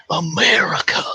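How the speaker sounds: high, hammed-up cry of the country's name; playful patriotic cry. Imagining himself migrating to America, playful patriotic cry. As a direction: belt it out as a mock-patriotic newcomer. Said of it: "then I'll be like".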